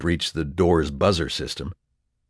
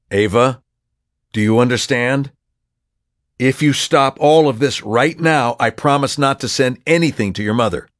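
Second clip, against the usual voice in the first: urgent raised voice; 6 semitones higher, 6 dB louder than usual. Acted. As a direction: press her hard to agree. Pleading on phone.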